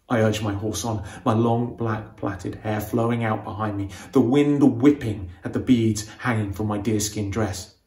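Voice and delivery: ordinary delivery, nothing marked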